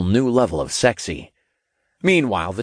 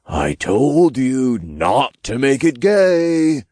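high, slow stage whisper; exaggerated, drawn-out imp scolding; loud whisper; 5 semitones higher, 4 dB louder, slower than usual. A narrator's reading. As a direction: chide a buddy in a loud aside. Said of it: "Todd whispered loudly".

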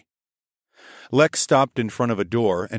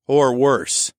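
menacing; 4 semitones higher, 6 dB louder than usual. A narrator's reading. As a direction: threaten him. Threatening the prisoner.

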